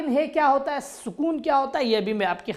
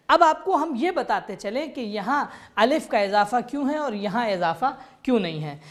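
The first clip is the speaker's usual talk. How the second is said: normal talking voice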